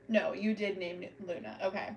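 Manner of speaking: ordinary talk, nothing marked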